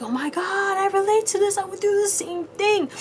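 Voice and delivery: high-pitched imitation of an excited fan; gushing fan. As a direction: gush it like someone who totally relates. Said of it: "They're like".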